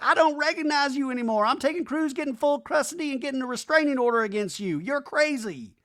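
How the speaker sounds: high, pleading mock Brittany; imitating Brittany. Recapping the fight, mimicking Brittany leaving Jax with a high voice. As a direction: pitch it high like a mock breakup. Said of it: "where she's like".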